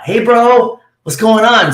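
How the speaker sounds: high-pitched, upbeat buddy hello; imitating an over-friendly pal; hearty bro greeting. Golf friend hailing a buddy, hearty.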